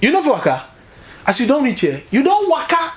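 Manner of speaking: high, excited pidgin banter; excited Urobo man. Retold or imitated. Urobo man at the Indian hospital greets a fellow Nigerian, excited and high. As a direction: cheerfully urge him to relax, pitched high. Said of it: "the man said".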